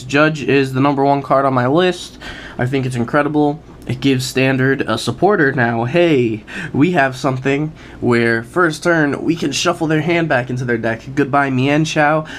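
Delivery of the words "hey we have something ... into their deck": usual speaking voice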